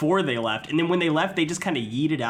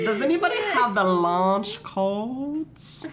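mock patriot voice; 8 semitones higher, slower than usual.

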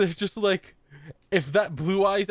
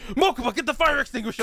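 frantic fast shout; 9 semitones higher, faster than usual. Retold or imitated. Voicing Kaiba panicking.

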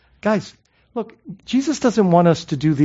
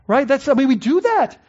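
normal talking voice

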